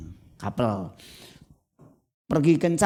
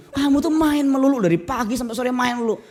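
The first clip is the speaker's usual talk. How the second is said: high, loud scolding nag; nagging parent; 9 semitones higher, 3 dB louder than usual. Retold or imitated. Parent scolds child for playing all day, loud nag. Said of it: "Kan orang tua suka begitu".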